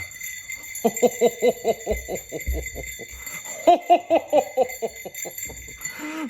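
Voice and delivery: jolly belly laugh; big hearty ho ho ho; Santa ho ho laugh. Acted.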